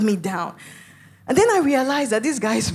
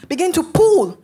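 loud, raised-pitch retold command; barked order; 6 semitones higher, 7 dB louder than usual. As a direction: re-enact a gunman ordering someone.

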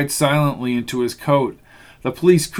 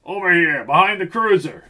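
higher, urgent yell; yelling cop; 4 semitones higher than usual. A narrator's reading. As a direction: call them over behind the car. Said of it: "yelled the gray-haired cop".

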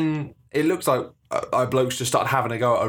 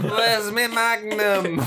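high, slow mock drunk whine; hammed-up boozy plea; drunk woman; 9 semitones higher, much slower than usual. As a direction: drawl it like a sozzled stranger.

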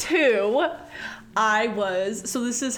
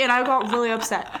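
usual speaking voice

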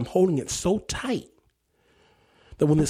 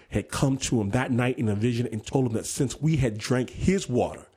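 usual speaking voice

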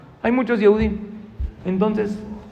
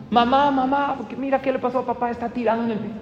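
high-pitched calling for mamá; acted panic, voice pitched well up; alarmed child; 8 semitones higher than usual. Boy calling his mother to his fainted father, alarmed.

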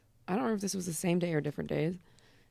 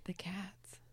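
whispering; 13 dB quieter than usual. Real, not acted. Hushed aside.

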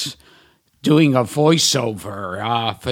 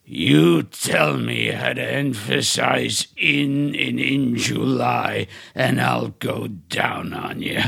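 imitating Orson Welles; 4 semitones lower than usual. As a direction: drag it out low like a grumpy Welles. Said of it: "And he goes".

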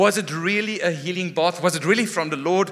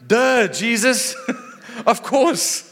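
higher, drawn-out duh with a laugh; mock duh; 6 semitones higher, 3 dB louder, slower than usual. Preacher mocking his own reaction to Jesus, higher and laughing. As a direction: playfully state the obvious to Jesus. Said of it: "I'm going like".

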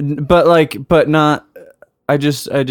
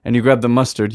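ordinary talk, nothing marked

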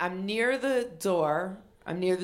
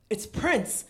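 sharp gasp, then higher-pitched exclamation; awed gasp; 5 semitones higher than usual. Retold or imitated. Fan reliving hearing [name] in the earbud, awed gasp. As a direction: gasp as if starstruck. Acts out her stunned reaction to [name].

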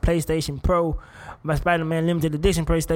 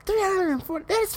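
shocked laugh; 12 semitones higher than usual. Real, not acted. Shocked at body count.

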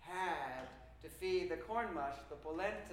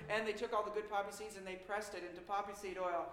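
usual speaking voice

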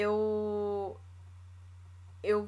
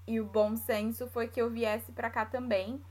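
ordinary talk

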